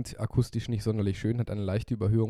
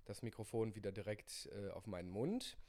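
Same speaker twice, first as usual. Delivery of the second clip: far from the mic, much softer; distant mic; 15 dB quieter than usual. Real, not acted. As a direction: step well back from the mic. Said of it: "Jetzt gehen wir mal wieder auf, ungefähr zwanzig Zentimeter".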